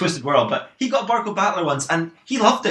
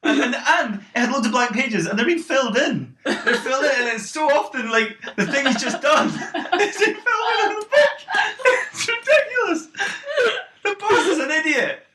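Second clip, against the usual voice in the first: giggling through the words; can barely get it out for laughing; high giggly laughing; 10 semitones higher than usual. Real, not acted.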